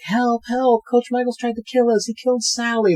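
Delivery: high, panicky mock cries; squealed alarm without real volume; imitated yelling for help. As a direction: play fleeing girls calling for help. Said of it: "They're yelling".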